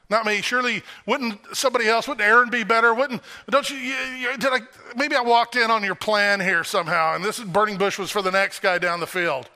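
high, pleading objections, tumbling over words; pleading Moses. Retold or imitated. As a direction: beg to be let off the hook.

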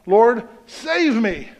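a desperate cry, stretched long; crying out for help. Acted. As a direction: call out slowly as if sinking. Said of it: "he cried out".

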